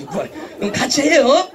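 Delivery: ordinary talk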